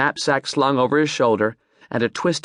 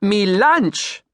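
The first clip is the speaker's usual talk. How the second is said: "Mi lunch!" louder, lunch falling hard; emphatic Creole accent; 8 semitones higher, 3 dB louder than usual. Acted. Captain slapping his boat, emphatic Creole accent. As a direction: slap it out, proud Creole captain. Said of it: "He slapped a hand on the side".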